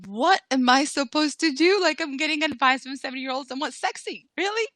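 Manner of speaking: can't believe the advice she's getting; exasperated rising disbelief; incredulous inner voice. Retold or imitated. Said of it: "in her head, she's like".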